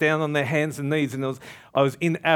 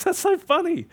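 high-pitched mock laughing fit; imitating laughing pastor; 14 semitones higher than usual. Pastor hearing he was nearly shot, amused, laughing hard. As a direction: squeal it through imitated laughter, much higher. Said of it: "The pastor's laughing".

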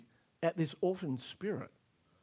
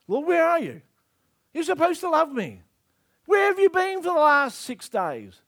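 high, loud hurt accusation; pleading protest, pitched up; acted reproach; 9 semitones higher, 7 dB louder than usual. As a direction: demand an answer from the one who let you down. Voices Mary's reproach to Jesus.

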